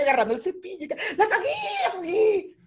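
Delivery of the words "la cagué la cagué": high mock shriek of frustration; rabia temblorosa exagerada. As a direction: wail it high, hammed-up fury. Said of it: "que me temblaba la cabeza, weón, la rechera". (chillido de rabia exagerado)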